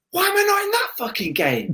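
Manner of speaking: high-pitched exasperated yelp of disbelief; frustrated outburst. Retold or imitated. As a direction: squeal it out, indignant and fed up. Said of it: "And I'm like".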